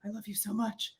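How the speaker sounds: soft, hushed I love you; tender murmur. Retold or imitated. Wife answering her husband, soft tender murmur. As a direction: melt into it, barely above a breath.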